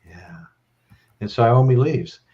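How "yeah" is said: barely voiced, low drunk yeah; drunk mumble. A drunk [name] answers his friend, faint mumble. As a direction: mumble it half asleep. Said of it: "[name] looks at him and goes".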